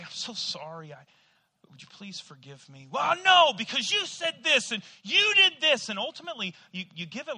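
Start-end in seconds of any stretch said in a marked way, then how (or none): 0.0-2.9 soft apology. Retold or imitated. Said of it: "You say". 2.9-5.9 resentful refusal. Retold or imitated. Offended person refusing forgiveness.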